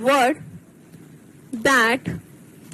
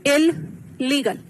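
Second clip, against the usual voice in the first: "Illegal" slowly, a clear pause after इल; split into morphemes; much slower than usual. Shown as a demonstration. Teacher showing students how a word divides, split into parts. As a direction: break "illegal" into इल, then legal. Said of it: "जब मैंने इसको तोड़ा".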